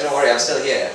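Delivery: ordinary talk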